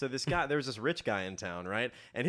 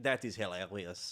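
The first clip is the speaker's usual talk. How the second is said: put-on French accent; 4 semitones lower, 6 dB quieter than usual. French advisor reacting to the disguise.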